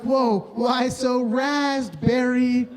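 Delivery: slow hammed-up yell at an angry dad; mock shouted tease. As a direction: stretch it out slowly and shout the pun.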